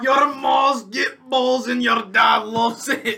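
put-on slow, drawn-out Scottish accent; mock Scottish accent. Friend trading silly insults, drawn-out mock Scottish accent. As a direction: stretch it out in a hammed-up Scottish accent. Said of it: "language? Like, like from where?".

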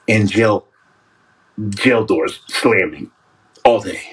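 very low, grim monotone; haunted ex-prisoner. Acted. Livestreamer acting out solitary confinement for viewers, haunted low voice. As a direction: drop right down and brood over each phrase.

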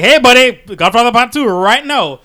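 high, loud mock studio pitch; pushy studio exec. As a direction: bellow it like a pushy exec on the phone. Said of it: "Knock Knock Knock Studio's like".

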